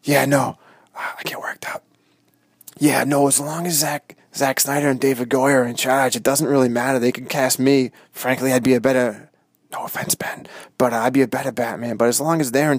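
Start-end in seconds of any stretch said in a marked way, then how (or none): 0.9-1.8 sheepish whisper. Pretend. Hunter whispering an apology. Hush during the hunt. 9.7-10.5 whispered aside. Pretend. Hunter whispering an aside to Ben.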